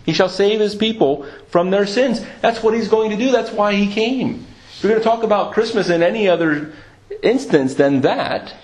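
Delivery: impassioned sermon delivery; fervent preaching. Acted. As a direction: press the point home to the congregation. Preaches with rising fervour.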